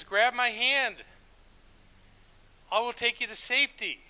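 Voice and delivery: rescuer yelling from a helicopter. Acted. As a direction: shout it down from the helicopter. Helicopter rescuer shouting over noise.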